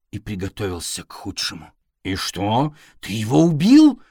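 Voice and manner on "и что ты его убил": bursts out high and loud; can't hold it in, loud and high; blurting cat. A narrator's reading. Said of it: "не выдержал кот".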